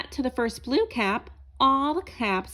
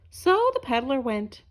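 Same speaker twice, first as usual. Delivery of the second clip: usual speaking voice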